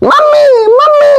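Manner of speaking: put-on extremely high, slow squeaky baby. Baby asked to name its mother, squeaky baby voice. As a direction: squeal it like a cartoon toddler.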